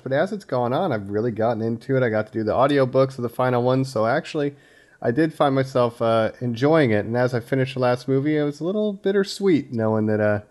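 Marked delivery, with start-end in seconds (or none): none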